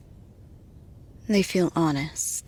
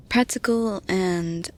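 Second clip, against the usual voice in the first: ordinary delivery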